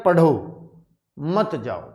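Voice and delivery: पढ़ो on its own, a long stop, then मत जाओ together; pause after पढ़ो. Shown as a demonstration. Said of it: "पढ़ो के बाद बाप रुका".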